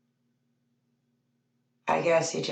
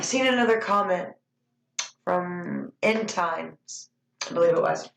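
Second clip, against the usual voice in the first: normal talking voice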